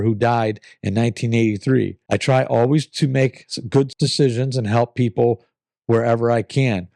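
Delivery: ordinary delivery, nothing marked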